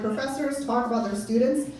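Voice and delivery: ordinary delivery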